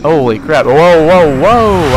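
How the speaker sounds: loud, alarmed whoas; startled shout. Real, not acted. Nearly caught in an anomaly, real startled shouting. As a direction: jolt back from sudden danger, shouting.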